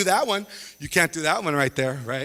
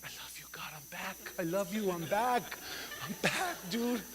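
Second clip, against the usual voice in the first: whispered prayer; 10 dB quieter than usual. Retold or imitated.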